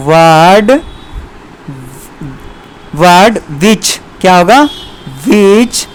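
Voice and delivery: each word separated by long pauses; slow classroom dictation. Shown as a demonstration. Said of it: "हम एक line में लिख सकते हैं".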